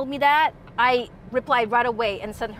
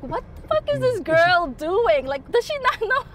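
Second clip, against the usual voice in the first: incredulous attendant; 8 semitones higher than usual. Retold or imitated. Voicing attendant's incredulous thoughts.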